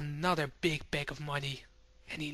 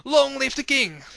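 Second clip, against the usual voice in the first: cheering crowd; 8 semitones higher, 6 dB louder than usual. Acted. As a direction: cheer for the king. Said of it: "All people cheer at him".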